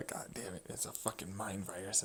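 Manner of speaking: breathy whisper of God damn it; whispered curse. Real, not acted. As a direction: whisper the swear. Muttered curse.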